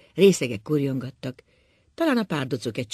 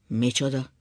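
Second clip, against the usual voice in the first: usual speaking voice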